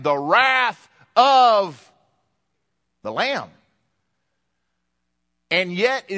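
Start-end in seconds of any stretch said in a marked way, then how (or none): 0.0-1.9 "the wrath of" drawn out slow, higher and louder; wrath stressed. Shown as a demonstration. Preacher shocking the congregation, slow loud "the wrath of". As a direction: lean hard and slow on "wrath", a surprise. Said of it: "last thing you would expect to hear".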